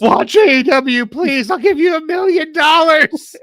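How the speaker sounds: high, slow, begging little voice; pleading squeaky voice. Pretend. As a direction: beg the viewers in a high squeak. Pleading bribe to viewers.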